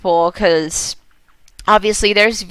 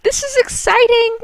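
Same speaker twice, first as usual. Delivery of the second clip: high, thrilled squeal; bursting with glee; excited. Real, not acted. Gamer squeals to viewers that the game is exciting.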